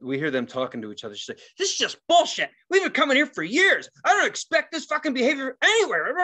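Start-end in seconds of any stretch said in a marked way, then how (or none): 1.6-6.1 high, loud mock tirade; indignant woman ranting. Angry guest after the ghost left, high indignant rant. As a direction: imitate an outraged regular customer.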